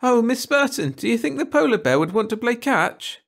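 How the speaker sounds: put-on high, loud child's voice; giggly little girl. A narrator's reading. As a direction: play an eager little girl asking teacher. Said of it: "Francis giggled".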